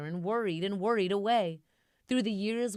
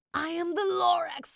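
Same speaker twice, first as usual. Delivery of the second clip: high, loud creature voice; high loud Lorax announcing himself; 11 semitones higher, 5 dB louder than usual. A narrator's reading. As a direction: puff up as the grumpy Lorax. Lorax voice in story.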